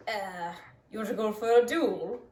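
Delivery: mock Scottish challenge that slips into English; botched Scottish accent. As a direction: attempt a Scot's accent and land closer to English. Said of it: "No, that was pretty English".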